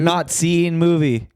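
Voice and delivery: mocking echo. Mockingly echoes friend's phrase.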